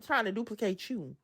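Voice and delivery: normal talking voice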